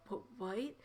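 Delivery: hushed, halting disbelief; acted quiet shock; stunned dread. A narrator's reading. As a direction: stammer softly, then draw the question out slowly. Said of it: "her face becoming pale".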